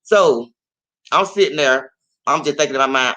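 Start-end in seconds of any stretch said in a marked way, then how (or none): none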